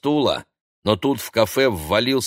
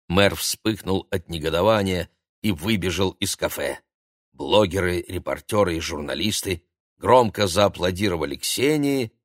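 usual speaking voice

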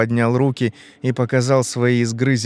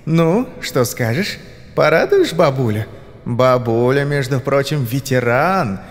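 put-on coaxing old hag; 5 semitones higher than usual. Old woman talks Ivan into becoming soup, mock-sweet coaxing. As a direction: lure him in with an exaggerated old-woman lilt.

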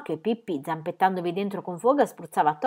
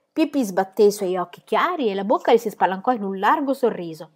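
usual speaking voice

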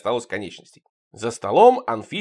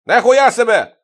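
high, very loud outburst; loud astonishment; 9 semitones higher, 11 dB louder than usual. A narrator's reading. Shokoladze amazed at what he sees, shouting in surprise. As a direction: exclaim it in shock. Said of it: "удивился Шоколадзе".

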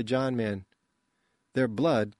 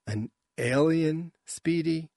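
stunned disbelief; 5 semitones higher, slower than usual. Acted. Adel shocked at alien news.

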